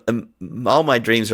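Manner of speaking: ordinary delivery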